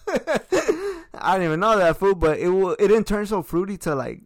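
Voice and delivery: tipsy bar argument. Real, not acted.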